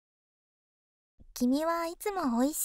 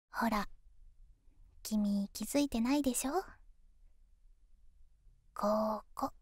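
pillow talk; 2 semitones lower than usual. Acted. Girlfriend soothing tired boyfriend.

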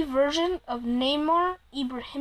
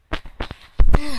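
sigh; 13 dB louder than usual. Real, not acted. Exasperated at silent friend.

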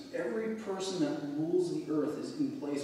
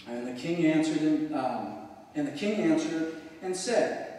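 louder, solemn Bible reading; scripture reading; 5 dB louder than usual. Acted. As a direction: proclaim the passage from the pulpit. Reads scripture aloud.

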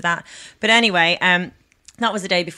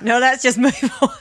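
higher, laughing dismissal; 6 semitones higher, 3 dB louder than usual. Real, not acted. To co-hosts after a failed accent, laughing. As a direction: laugh it off and move along.

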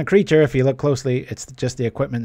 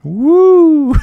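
very high, very loud wrestler's woo; a full hammed-up wrestling promo yell; Ric Flair; 13 dB louder than usual. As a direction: belt out the wrestler's signature woo. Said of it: "Ric Flair".

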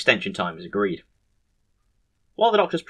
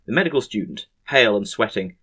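ordinary delivery, nothing marked

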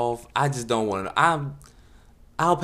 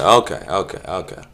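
ordinary talk, nothing marked

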